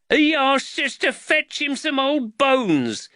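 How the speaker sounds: slow, high-pitched lad's accusation; aggrieved youngster telling on a grown-up; accusing boy. A narrator's reading. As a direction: put the blame on him in a high boy's voice.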